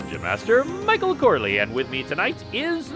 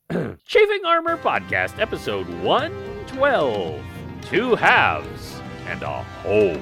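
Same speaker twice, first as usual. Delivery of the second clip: podcast announcer; 3 semitones higher than usual. Real, not acted.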